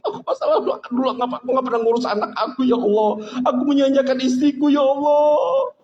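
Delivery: acted wailing prayer, pitched high; demonstrated weeping repentance; tearful confession. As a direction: plead with God as if sobbing with regret. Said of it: "tahajud sampai kita nggak pernah nangis".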